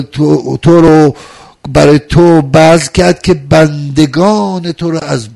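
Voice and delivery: loud, slow and high, every phrase hammered out; full-force preaching. Acted. Preacher to mourners on a Muharram night, loud and slow. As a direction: preach it to the hall, drawn out and loud.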